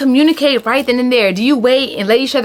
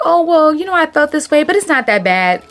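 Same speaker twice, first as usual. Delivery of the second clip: higher-pitched, hedging tone; lightly brushing it off; sugarcoating; 5 semitones higher than usual. Acted. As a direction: soften it as if it's no big deal. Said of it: "if you try to sugarcoat things".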